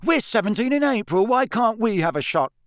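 high-pitched aggrieved complaint; worked-up, high-pitched objection; indignant protest. A narrator's reading. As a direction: argue against an unfair rule. George protesting the age limit.

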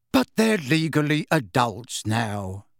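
slow, weary reasoning; tired voice. A narrator's reading. Husband answering his wife's objection, tired voice. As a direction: press the point slowly, tiredly. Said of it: "in the same tired voice".

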